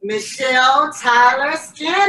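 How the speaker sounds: loud, drawn-out winner call; hyped giveaway announcement. Real, not acted.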